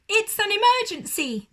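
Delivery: high, loud, fast cry; shouting. Acted. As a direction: make it sound urgent and alarmed. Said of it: "cried Aussie".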